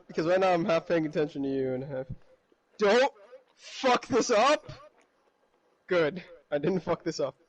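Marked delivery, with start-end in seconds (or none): 2.8-4.7 high, drawn-out yell; real yell, stretched out. Real, not acted. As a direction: yell it slowly, pitched up. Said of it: "I just yelled".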